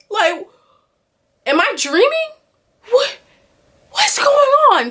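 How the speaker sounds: rising bewildered panic; confused shock. Retold or imitated. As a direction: pitch climbing high in disbelief. Waking to hundreds of death posts.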